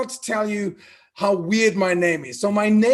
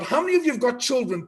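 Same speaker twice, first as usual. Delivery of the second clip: ordinary delivery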